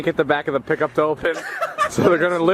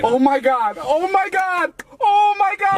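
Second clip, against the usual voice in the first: over-the-top excited reaction; slower than usual. Shown as a demonstration. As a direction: go big, screaming with joy. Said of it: "here's a good reaction".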